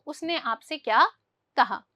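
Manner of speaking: normal talking voice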